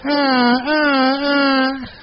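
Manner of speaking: high mock bray breaking into a laugh; donkey braying. Preacher's second go at the donkey's bray. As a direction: bray it, then laugh at the attempt. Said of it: "has to be good enough for you".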